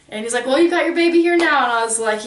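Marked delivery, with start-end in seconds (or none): 0.4-1.6 higher, louder mock of a careless doctor; mock dismissive doctor. Mother mimics the anesthesiologist excusing his failure, higher and louder. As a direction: toss it off like a smug doctor.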